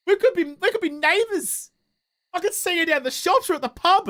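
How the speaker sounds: high, eager and delighted; thrilled at finding a neighbour; excited. Real, not acted. Streamer to a local viewer in chat, high excited voice. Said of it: "Oh no, we could be neighbors".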